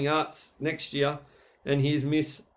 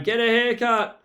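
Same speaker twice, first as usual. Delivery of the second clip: mock yell from the crowd, higher and louder; crowd heckle; 6 semitones higher, 5 dB louder than usual. Heckler in the crowd yelling at Mister Graham, mock shout. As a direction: heckle him from the stands. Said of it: "Someone in the crowd yelled out".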